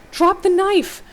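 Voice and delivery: high, loud shouted command; barked order. A narrator's reading. Male voice ordering hero to drop knife, barked. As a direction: bark it like an urgent warning. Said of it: "male voice bark at you".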